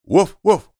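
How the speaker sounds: high, loud little woofs; puppy barking. Acted. Puppy barking at the giant turnip, high yips. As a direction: bark like an excited puppy. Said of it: "the puppy barked".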